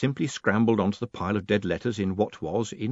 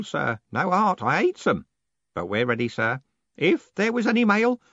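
high, aged voice, complaining; a fussy old clerk lamenting the clacks; old postman griping to Moist; old Groat grumbling; 9 semitones higher than usual. A narrator's reading.

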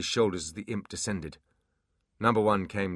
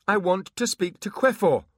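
louder, firmer high plea; 12 semitones higher, 7 dB louder than usual. Acted. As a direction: push it a little louder. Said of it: "with a little more volume".

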